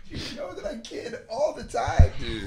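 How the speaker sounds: exasperated high-voiced appeal to be believed; high-pitched pleading. Real, not acted. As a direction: plead up high, stretching the words. Pleading that he always jokes.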